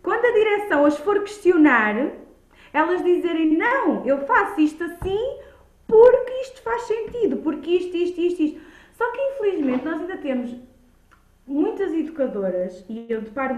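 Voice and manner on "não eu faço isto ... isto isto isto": imitated confident reply. Educator defending practice to direction.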